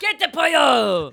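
slow, loud comic yell; mock shout. Host keeps up the chicken chase, slow loud yell. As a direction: bellow it chasing the chicken.

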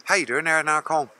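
put-on light Northern Irish Magherafelt accent; a hint of Magherafelt Northern Irish. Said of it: "that's Magherafelt".

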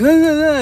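high mocking ah ah yells; mock drunken yelling. Mocking the drunk man yelling in the parking lot, high yells. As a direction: yell wordlessly like the drunk. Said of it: "yelling and, like".

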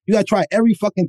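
ordinary delivery

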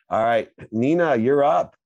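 loud, high host call-up. Real, not acted. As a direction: call the next guest up with a big lift. Host calls next caller up.

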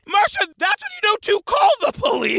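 high-pitched comic outburst; mock exasperated scolding. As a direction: scold her in exaggerated disbelief. Scolding the absent witness.

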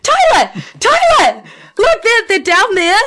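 high, excited shouting at a friend; frantic, pitch way up, grabbing his arm; freaking out. Retold or imitated. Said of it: "freaking out".

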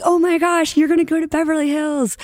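high, excited squeal of classmates; gushing classmates. Retold or imitated. Classmates squealing over her Beverly Hills dream job, high and excited. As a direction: squeal it like starstruck friends.

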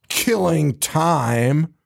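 very slow, drawn-out killing time; mock menacing killer; menacing pun. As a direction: stretch the pun out like a slasher. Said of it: "Psycho Cop is just staring at him and goes".

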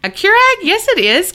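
high, loud incredulous retort; indignant disbelief. Retold or imitated. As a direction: protest it high, sure you're right. Retold protest at being corrected.

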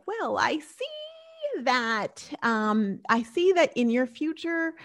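high, very slow mystic fortune-teller voice. Retold or imitated. Fortune teller reading palms for friends, mystic drawl. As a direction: draw it out like a mysterious seer. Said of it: "And she's like".

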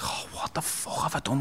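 whispered dismay, retold; stunned regret. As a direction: breathe it out softly in horror at yourself. Retold regret after hurting his mum.

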